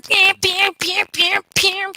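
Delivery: high repeated siren blares; imitated alarm. Recapping the base alarm going off, high imitated blare. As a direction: mimic a blaring alarm, high and steady. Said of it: "the alarm starts blaring".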